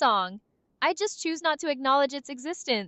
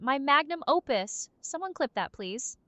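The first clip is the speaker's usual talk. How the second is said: ordinary delivery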